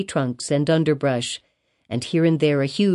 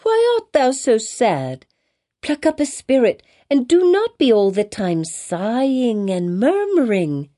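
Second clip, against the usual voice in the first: put-on child crying out; 6 semitones higher than usual. A narrator's reading. Child urging a sad brook to cheer up, crying out. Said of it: "cried Pearl".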